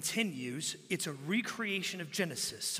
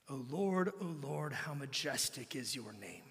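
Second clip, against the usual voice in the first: softer and softer, ending in a whisper; reverent whisper; 7 semitones lower, 8 dB quieter than usual. Acted. Preacher reciting Psalm eight to the congregation: reverent whisper. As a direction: hush down into a prayerful whisper.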